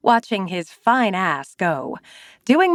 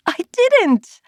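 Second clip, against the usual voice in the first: squeaky denial holding back a laugh; high giggly denial; 12 semitones higher, 3 dB louder than usual. A narrator's reading. Narrator denying it to angry Zane, fighting laughter. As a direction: deny it while laughing.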